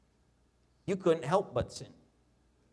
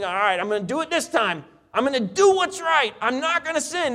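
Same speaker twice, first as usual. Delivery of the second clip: fired-up vow; 9 semitones higher, 5 dB louder than usual. Retold or imitated. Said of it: "he'd hear a message".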